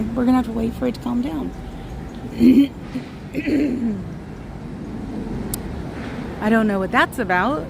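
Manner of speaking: usual speaking voice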